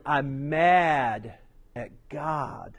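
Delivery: drawn-out, slow repetition; slow emphasis. Acted. Preacher repeating the brother's words to the congregation, slowly. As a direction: let each word land slowly. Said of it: "repeated slowly for emphasis".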